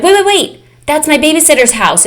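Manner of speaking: sudden high alarm, "wait" repeated fast; a little girl realizing something's wrong; alarmed child. Retold or imitated. Child notices passing house, alarmed.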